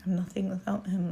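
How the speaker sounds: breathy whispered confession. Real, not acted. Woman confessing her faith on a live, whispered. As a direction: drop to a whisper, as if only to herself. Emotional testimony about God.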